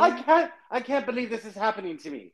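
high put-on British moan about the bad luck; a hammed-up British accent; mock British lament. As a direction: wail it like a stranded posh tourist. British passenger complaining voiced.

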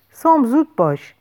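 urgent call. A narrator's reading. Narrator voices the hero urging Sam to hurry, urgent call. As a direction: call out to hurry someone along.

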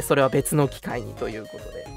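ordinary delivery

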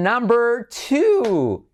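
high, drawn-out call that cracks. Real, not acted. Host announcing error number two, voice cracking. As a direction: stretch "two" up high until it squawks. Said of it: "Oh, qué gallo".

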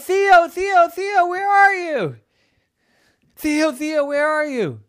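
high, loud, panicked mom calling out; frantic calling. Acted. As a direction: call for your child in alarm. Scared Mommy calls out for Theo.

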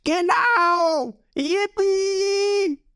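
high, drawn-out jubilant squeal; gleeful crowd cheer. A narrator's reading. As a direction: whoop it for your boss. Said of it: "Seine Leute jubeln ihm zu".

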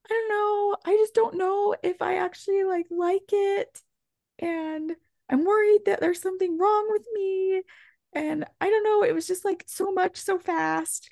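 high, tearful imitation of him; imitating crying husband. Luke confessing his fears to his wife, teary. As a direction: choke it out the way he cried. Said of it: "Luke, starts crying".

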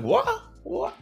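very high, squeaked surprise; acted astonishment. Podcaster reacting to a fan's kind message, acted astonishment. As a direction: replay a stunned reaction, pitch leaping up.

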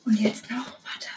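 very soft whisper; ASMR whisper. Shown as a demonstration. Podcaster moving on to the ASMR part, whispering. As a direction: whisper it close, barely audible. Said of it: "wir fangen an mit A S M R".

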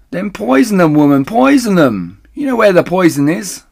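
loud, high, curt command; impatient order. Acted. Missus Bean orders Mabel from upstairs, loud and curt. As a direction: bark the order down.

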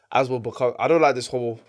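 ordinary talk

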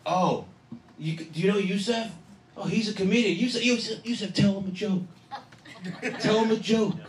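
high-pitched mock excited friends; pestering old friends. Old friends showing off their comedian pal, high mock wheedling. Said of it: "they pull out the old razzle dazzle".

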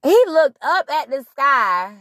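ordinary talk, nothing marked

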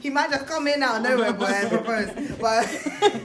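laughing high and loud through the joke; laughing loudly while joking. Real, not acted. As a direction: giggle out the tease. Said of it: "I'm joking".

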